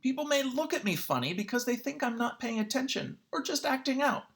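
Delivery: usual speaking voice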